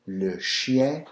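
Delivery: chien with a nasal French ending. Shown as a demonstration.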